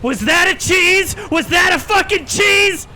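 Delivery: high, loud and slow, yelling in victory; real gloating shout at the absent critic; triumphant shout. Real, not acted. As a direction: bellow it out after winning the fight. Taunting after beating the boss.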